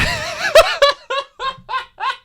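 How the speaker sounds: laughing high and breathless. Real, not acted. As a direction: burst into giggles. Reader laughing.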